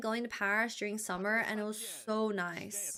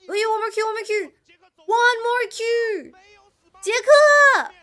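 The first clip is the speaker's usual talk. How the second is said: shrieking jackpot winner; 11 semitones higher, 13 dB louder than usual. Real, not acted. Co-streaming a tense teamfight.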